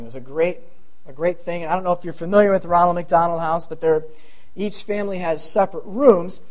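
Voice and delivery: ordinary talk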